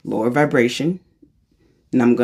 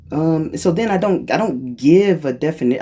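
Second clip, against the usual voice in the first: usual speaking voice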